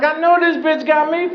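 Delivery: high indignant inner rant; mock outrage. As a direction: fume at the clerk in a squeaky voice. Inner rage at the clerk.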